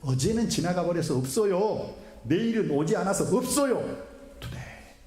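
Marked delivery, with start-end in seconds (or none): 4.4-4.9 one whispered word, Today. Shown as a demonstration. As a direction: hush it like a secret. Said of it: "속삭인 강조". (조용히 속삭이는 Today)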